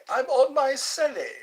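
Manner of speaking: "I'm on my celly" squealed high and loud. Acted. Telling chat he streams from his celly, in a high loud squeal.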